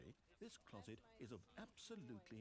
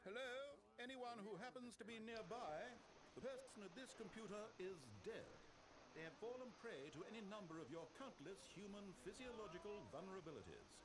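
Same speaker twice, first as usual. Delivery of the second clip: higher voice hailing the room; calling out; 9 semitones higher than usual. Acted. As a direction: call out to whoever is nearby. Calling out to anyone nearby.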